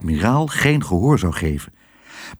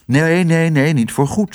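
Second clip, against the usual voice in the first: haastig; 6 semitones higher than usual. Acted. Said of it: "zei Tos haastig".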